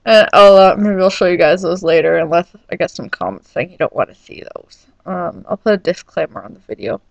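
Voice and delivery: usual speaking voice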